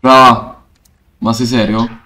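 ordinary talk

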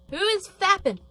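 high, loud shocked question; shocked and calling out; high startled demand; startled outcry. Real, not acted. Startled outburst.

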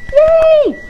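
sudden high, loud yay; excited cheer. Real, not acted. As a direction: cheer as the timer goes off. Cake finally done in oven.

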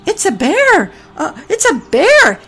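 high, loud alarm call; panicked goose. A narrator's reading. Goose spotting the bear, loud frightened alarm. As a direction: shriek the warning.

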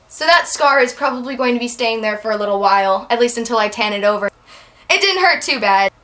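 ordinary talk, nothing marked

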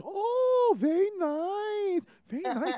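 very high, drawn-out auntie coo; slow sing-song admiring auntie; voiced local auntie. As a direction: imitate an impressed auntie, way up high.

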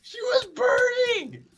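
high-pitched indignant wail; outraged yelling. Real, not acted. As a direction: cry out in disbelief. Killed by burning enemy.

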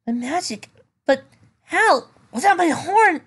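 high, rising shocked voice; shocked disbelief. Acted. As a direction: gasp it out in disbelief. Character voice in disbelief.

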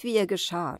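usual speaking voice

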